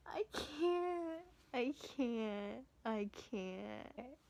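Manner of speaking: fighting back real tears; near tears. Real, not acted. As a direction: voice breaking into crying. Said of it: "real tears brewing".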